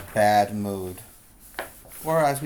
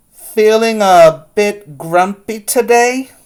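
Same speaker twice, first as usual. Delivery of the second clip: higher, louder kindly grandma question; higher, louder grandma checking on a grumpy child; Grandma's voice; 8 semitones higher, 14 dB louder than usual. Acted. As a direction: ask it like a teasing grandma. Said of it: "Grandma asked".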